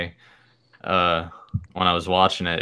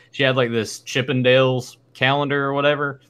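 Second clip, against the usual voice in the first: normal talking voice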